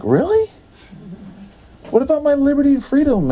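high-pitched, rushed objection; incredulous protest. Retold or imitated. Believer told to wear slacks, protesting in disbelief. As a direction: start high in disbelief, then argue quickly. Protesting the request to change clothes.

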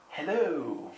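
a hint of a dreamy, floaty hello; spacey hello. Shown as a demonstration. Greeting the dazed girl in a lightly spacey voice. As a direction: drift the word out like you're half asleep. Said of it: "You have to say it like that because they're spacey".

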